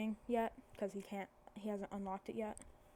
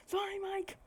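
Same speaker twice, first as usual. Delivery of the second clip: high-pitched acted apology; hasty correction; 10 semitones higher than usual.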